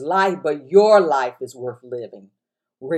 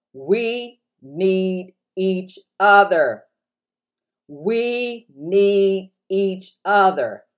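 slow emphatic preaching; 3 semitones higher, much slower than usual. Acted. Repeated slow preaching line.

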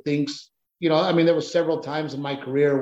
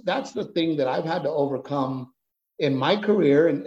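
ordinary talk

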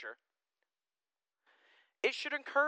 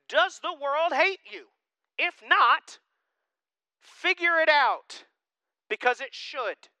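high, loud, slow challenge; forceful preaching; 10 semitones higher, 7 dB louder, slower than usual. Real, not acted. Pastor challenging church on being hated, forceful preaching. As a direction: hammer each phrase at the congregation.

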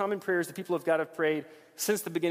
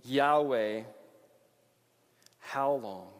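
measured, quiet, grave lament; reading David's lament; 4 semitones lower, 4 dB quieter than usual. Retold or imitated. Preacher reading David's lament, quiet and grave. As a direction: read it like an ache. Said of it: "He says".

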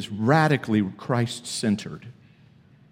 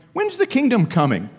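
mock Pharisee question; 12 semitones higher, 5 dB louder than usual.

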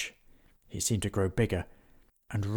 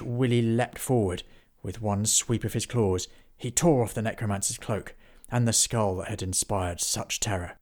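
plain reading voice

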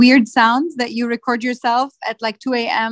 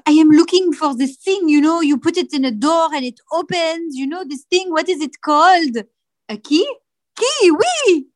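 higher, hammed-up French accent; 6 semitones higher than usual. A Frenchman hunting for a key, hammed-up French accent. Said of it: "I have a better one".

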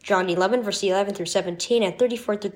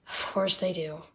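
very soft motherly reassurance; soft, low comfort; tender reassurance; 3 semitones lower, 11 dB quieter than usual. A narrator's reading. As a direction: say it gently, hushed. Mother comforts her hurting son.

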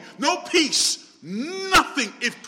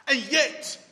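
normal talking voice

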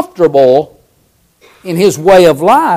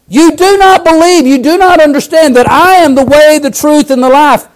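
full-force preaching; 8 semitones higher than usual. Acted. Preacher urging the congregation, full-force preaching. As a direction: preach it out to the church.

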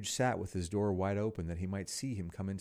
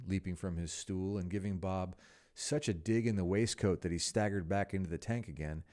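ordinary delivery, nothing marked

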